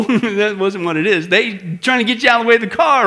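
ordinary delivery

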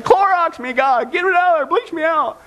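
mock prayer. Preacher voicing a desperate sinner, high and urgent. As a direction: cry out to be bleached clean. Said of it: "You know what I'm saying".

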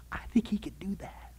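whispered, wry understatement; soft conspiratorial whisper to the congregation; dry whisper. Real, not acted. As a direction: breathe it out as a knowing whisper. Dry aside after the creation argument.

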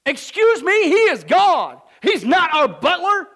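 loud, high indignant preaching; fiery preaching. Acted. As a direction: thunder it from the pulpit. Preaching at full force.

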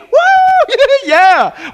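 high, loud holler into a shouted yeah; jubilant whoop. Acted. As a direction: celebrate at full voice. Reliving joy of being saved.

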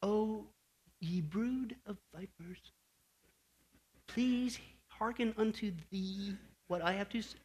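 lightly voiced, soft and sweet old-fashioned scolding; a hint of a too-polite preacher, soft and slow; mock gentle polite rebuke. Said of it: "He wasn't up there saying".

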